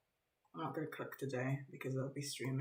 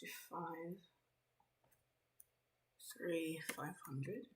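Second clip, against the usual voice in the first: whispering to self; 10 dB quieter, slower than usual. Real, not acted.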